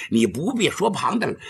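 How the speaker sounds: plain reading voice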